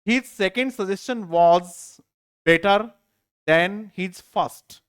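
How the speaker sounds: very slow, word by word reading; slow dictation. Shown as a demonstration. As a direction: read it out slowly for students to copy.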